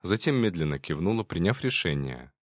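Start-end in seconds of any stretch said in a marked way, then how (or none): none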